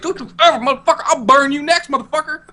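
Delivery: very high mock threat; Khaleesi. Queen threatening to burn the next offender, high comic menace. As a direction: threaten them in a hammed-up squeaky-high voice. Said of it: "she's like".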